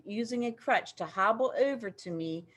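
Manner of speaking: plain reading voice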